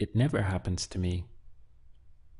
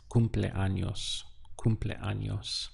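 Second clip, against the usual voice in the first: "cumpleaños" said slowly, each syllable spelled out in sound; teacher slowly modelling "cumpleaños" twice; slow clear pronunciation; slower than usual. Shown as a demonstration. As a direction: stretch out "cumpleaños" for the class. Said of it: "If you don't remember the word for birthday".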